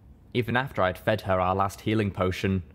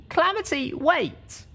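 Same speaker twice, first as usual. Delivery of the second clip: put-on a much higher young mare's voice calling wait; urgent call; slower than usual. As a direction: call her back, high and urgent. Littlepip calls out to Calamity.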